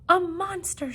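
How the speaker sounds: high, loud cry of alarm; frightened shout. Acted. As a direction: yell it out in fright. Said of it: "shouted Norval".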